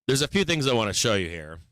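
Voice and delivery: ordinary talk, nothing marked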